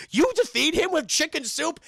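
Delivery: high, loud disbelieving question; can't believe what he just heard; young hero stunned by the mentor's advice, high and loud; incredulous. Acted. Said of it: "He's like".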